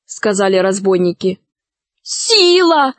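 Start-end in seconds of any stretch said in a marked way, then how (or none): 2.0-2.9 high, imploring call; urgent appeal by name; pleading. A narrator's reading. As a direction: beg for backing. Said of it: "взмолилась Алиса".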